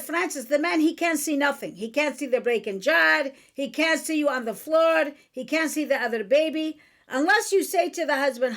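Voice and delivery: emphatic imitated Chaldean accent; mother's Chaldean accent. Mother telling Francis what men miss, in Chaldean accent. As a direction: imitate a Chaldean mother driving her point home. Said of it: "And in her accent".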